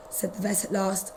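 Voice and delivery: ordinary delivery, nothing marked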